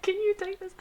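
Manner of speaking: high, light imitating ask; high mock voice. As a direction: lift it up high like a timid teammate. Imitating teammate handing it off.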